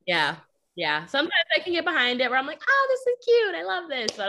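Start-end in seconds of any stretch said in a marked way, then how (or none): 2.7-4.1 much higher, louder, sweet gushing; gushing cutesy voice. Retold or imitated. Teacher acting out her frou-frou side, high and gushing. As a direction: coo over something cute in a high voice. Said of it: "Even my voice changed in that".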